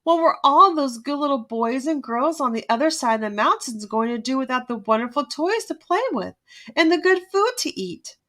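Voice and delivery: ordinary delivery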